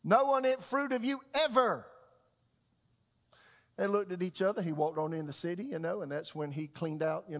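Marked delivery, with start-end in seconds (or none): 0.0-1.9 emphatic curse. Acted. Jesus cursing the fig tree for good, emphatic curse. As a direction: pronounce it with finality, hitting "ever".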